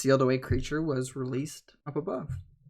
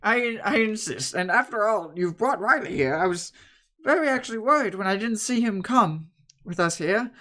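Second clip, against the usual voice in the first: lightly voiced, fussing old woman; old woman insisting; 6 semitones higher, 4 dB louder than usual. Bread woman offering gifts to the group, lightly voiced. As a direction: insist kindly like a granny.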